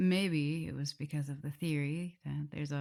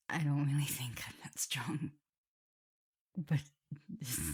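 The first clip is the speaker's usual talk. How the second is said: whisper. Acted. Shy self-doubt.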